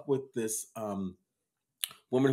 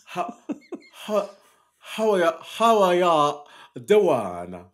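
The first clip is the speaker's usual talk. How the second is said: slow Japanese Southern drawl, higher; Japanese Southern accent; 5 semitones higher, 5 dB louder, slower than usual. Retold or imitated. Host imitates his friend's Japanese Southern accent for co-host. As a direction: imitate a Japanese woman from Texas. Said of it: "Japanese Southern accent".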